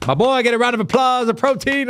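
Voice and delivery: high, excited call for applause; hype. Real, not acted. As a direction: pump up the crowd. Hyping guest's answer.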